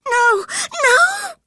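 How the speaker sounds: very high, very loud crying scream. A narrator's reading. Catherine screaming at Heathcliff not to leave. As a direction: scream it in panic. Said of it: "she screamed".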